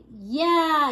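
mild, drawn-out cheer; a modest crowd yell. A narrator's reading. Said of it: "yelled the crowd".

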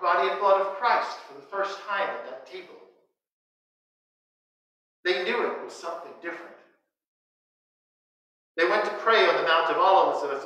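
ordinary talk, nothing marked

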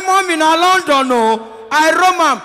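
high, loud call to mom; shouting into phone. Retold or imitated. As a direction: yell it down a bad line.

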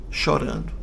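a soft unvoiced whisper of Chorando; a quiet afterthought; whispered echo. A narrator's reading. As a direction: breathe Chorando as a hushed echo.

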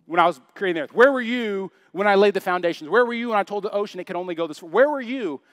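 insistent, lightly acted divine questioning; God challenging. God questioning Job about creation, insistent challenge. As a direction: hammer each repeated question home. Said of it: "He says".

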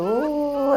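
high drawn-out howl like a jackal. Retold or imitated. Narrator imitating jackals howling at night, high howl. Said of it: "నక్కలు మోరెత్తి అరుస్తున్నట్టు".

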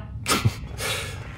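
a very quiet, breathy laugh; hushed laugh. Real, not acted. Rick laughs quietly among his friends. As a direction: laugh almost under the breath.

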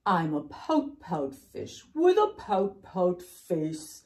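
loud, drawn-out dreary wail, then sinking blubs; big hammed-up gloomy fish refrain; glum pout-pout fish. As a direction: bellow the misery with stretched vowels. Voices the fish's refrain loudly.

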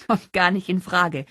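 emphatic, laughing refusal called out; calling out. A narrator's reading. Holly refusing Gary's challenge after laughing, calling out. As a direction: exclaim it between gasps of laughter. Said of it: "rief sie".

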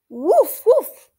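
loud high yappy puppy woof; puppy barks. Acted. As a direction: bark like an eager little puppy. Voicing the puppy Biscuit.

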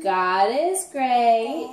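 slow, drawn-out grace; slow prayer. Real, not acted. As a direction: lead the prayer word by word. Leading child in grace.

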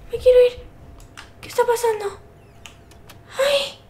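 real high-pitched, tearful panic; terrified wailing. Real, not acted. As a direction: wail as if desperate to flee. Terrified, wants to flee the game.

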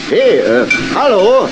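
high, loud hail across the platform; hey there, over here; calling out. Acted. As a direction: call out to get attention, stretched slowly. Calling out for someone at station.

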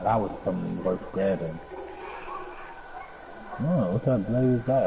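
ordinary delivery

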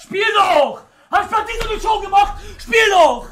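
high, loud impatient demand; irritated yell to get on with it; annoyed shouting. Real, not acted. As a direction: snap loudly at the opponent. Impatient at opponent's celebration.

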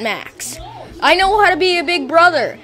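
ordinary delivery

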